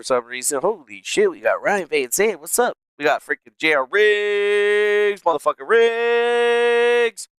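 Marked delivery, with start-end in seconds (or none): 3.0-7.3 hyped shout-out. Real, not acted. Streamer hyping a viewer in chat, slow excited call. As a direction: stretch the name out like an arena announcer.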